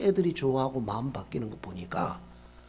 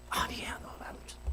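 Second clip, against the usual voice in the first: hushed, voiceless denial; scared whisper; 11 dB quieter, faster than usual. Retold or imitated. Caught shoplifting kids deny it to the shop owner, whispering.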